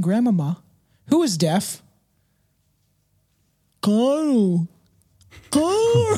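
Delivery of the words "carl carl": much higher, louder eerie wail of the name; dead grandmother's phantom call to Carl, eerie high wail; ghostly calling. Acted.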